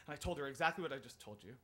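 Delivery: ordinary delivery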